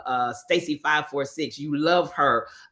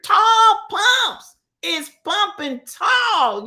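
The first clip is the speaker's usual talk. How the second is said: high, loud, drawn-out hail; slow, high-pitched hype call; excited shout-out; 13 semitones higher, 6 dB louder, much slower than usual. Real, not acted. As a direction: holler a big greeting, stretching it out. Calling out greeting to arriving viewer.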